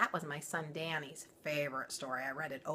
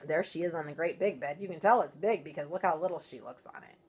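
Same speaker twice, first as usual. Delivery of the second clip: plain reading voice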